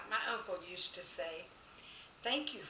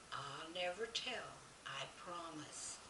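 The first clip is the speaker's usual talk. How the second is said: softer, slow secret promise; hushed promise; 3 semitones lower, 9 dB quieter than usual. Acted. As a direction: murmur it like a shared secret. Boy whispers secret to teacher.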